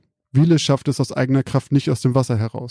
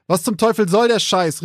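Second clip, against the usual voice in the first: pitched-up angry outburst; angry shout; 7 semitones higher than usual. Acted. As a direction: blurt it out furious and scared. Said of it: "rief er wütend".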